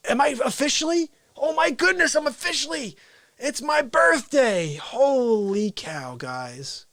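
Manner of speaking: high, amazed excitement; giddy birthday surprise; delighted realization. Real, not acted. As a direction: gasp it out high, then stretch the last words. Host realizes it is his birthday.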